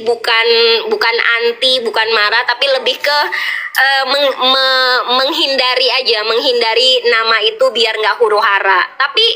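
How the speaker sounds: normal talking voice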